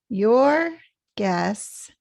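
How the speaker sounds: YOUR and GUESS both stressed strongly; equal stress. Shown as a demonstration. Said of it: "equally stressed and loud".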